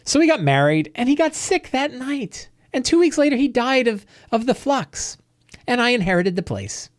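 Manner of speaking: high, put-on old innkeeper voice; old woman voice. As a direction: play the cheerfully sinister widow. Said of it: "angry old women".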